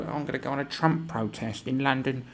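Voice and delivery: sing-song caricature of a do-gooder; mocked protester. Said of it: "These people who go".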